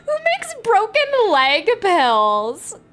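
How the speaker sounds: high, slow, drawn-out disbelief; incredulous outburst. Acted. Player baffled by broken leg pills, incredulous outburst. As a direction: stretch it into a comic wail.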